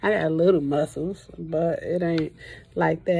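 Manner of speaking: ordinary delivery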